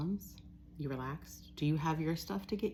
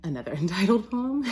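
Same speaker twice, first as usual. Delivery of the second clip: breaking into laughter; 6 semitones higher, 8 dB louder than usual. Real, not acted. Announcing yet another untitled poem to listeners, cracking up. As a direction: announce it louder and higher, rising into a giggle.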